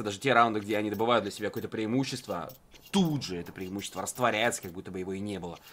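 ordinary talk

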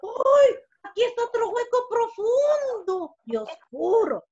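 high, loud storybook astonishment; amazed discovery. Acted. Fly finds the other ear hole, amazed. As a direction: exclaim with wide wonder.

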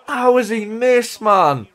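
high, drawn-out cry of disbelief; slow, high wail at a missed chance; exasperated disbelief. Real, not acted. Striker misses a sure goal.

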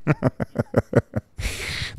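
a soft airless chuckle at his own joke; breathy silent laugh. Real, not acted. Agent laughing quietly at his own joke about screwing clients, breathy chuckle.

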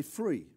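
free said with an f for the th. Shown as a demonstration. London speaker swapping th for f in free. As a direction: say free, an f where th goes. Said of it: "they don't pronounce their T H's".

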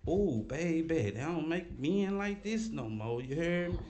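slow flirty lady. Retold or imitated.

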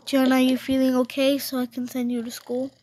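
ordinary delivery

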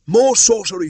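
high, loud panicked cry; villagers shouting. A narrator's reading. Villagers panicking at the musket, loud high shout. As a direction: yell it as a scared mob. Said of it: "shouted the villagers".

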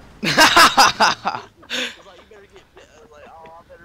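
high, loud burst of laughter. Real, not acted. Laughing after the chaos.

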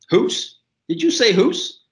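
high-pitched, incredulous questioning; puzzled surprise. A narrator's reading. Waitress puzzled by a customer's accent, high surprised questioning. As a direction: query the odd word in a high voice.

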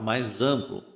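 ordinary delivery

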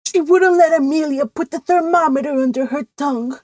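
high, plaintive whimper. A narrator's reading. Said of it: "whimpered Cousin Stickles".

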